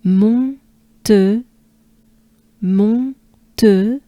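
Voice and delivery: "mon", pause, "te", nasal then rounded vowel; French syllables. Shown as a demonstration. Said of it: "so far we have".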